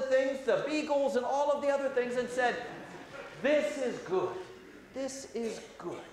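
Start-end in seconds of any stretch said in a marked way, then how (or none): 3.4-6.0 gentle blessing. Acted. God blessing creation.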